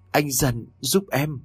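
put-on high, eerie plea from a dead girl; a ghost calling faintly for help; lightly voiced ghostly girl begging; ghost girl pleading. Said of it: "tiếng của Hạ lại vang lên".

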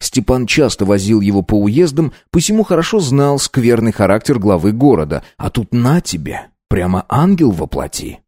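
usual speaking voice

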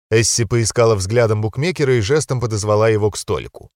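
usual speaking voice

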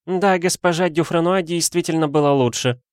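lightly high-voiced lady; offhand madame. A narrator's reading. Madame correcting her admirer, offhand. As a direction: drop it casually, pitched high. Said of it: "проронила хозяйка номера".

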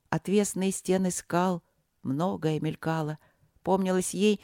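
ordinary delivery

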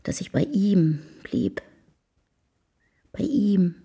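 hushed, breathy whisper, as if confiding a secret. Acted. Woman recalling how the duke's confidant took her in, whispered. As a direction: whisper the memory to yourself. Private memory told in whisper.

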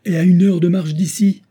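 high, loud outburst; impassioned cry. A narrator's reading. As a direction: announce it urgently at full voice. Olivia's outburst at the altar continues.

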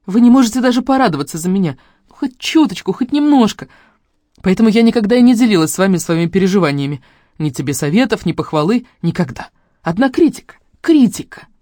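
high, hurt, reproachful outburst; upset. A narrator's reading. Daughter reproaching family for constant criticism, upset. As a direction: pour out the hurt, peaking on the last word. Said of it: "Огорчённо всхлипнула я".

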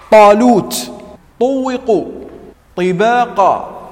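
طالوت, طوقوا, طباقا with a loud, heavy emphatic ط; loud, slow emphatic ط examples. Shown as a demonstration. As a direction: punch each heavy ط. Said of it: "وكذا الطاء".

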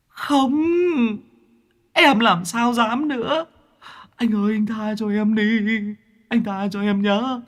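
high, whining plea; wheedling ghost pleading for mercy; whiny ghost begging. A narrator's reading. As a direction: ghost begging Mạc to spare him. Ghost keeps begging Mạc.

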